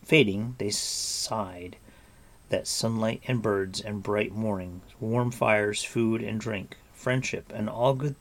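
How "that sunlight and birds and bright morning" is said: plain reading voice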